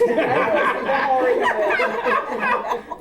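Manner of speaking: slow, laughing repeat of ballerina bitch; giggling over ballerina bitch. Real, not acted.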